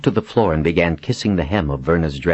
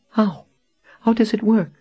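very high-pitched, eager question; desperately hopeful. A narrator's reading. Captive clutches her friend, asking about the magic, desperately hopeful. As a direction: ask high, grasping at hope.

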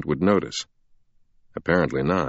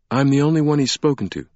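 higher-pitched, quicker character voice; Sienna; 8 semitones higher, faster than usual. Acted. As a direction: explain briskly in a raised pitch. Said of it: "Sienna said".

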